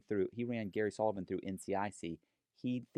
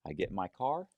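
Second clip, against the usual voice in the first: ordinary talk, nothing marked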